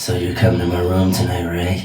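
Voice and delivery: low, soft flirty come-on, lightly imitated; creepy voice. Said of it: "That's your creepy voice?".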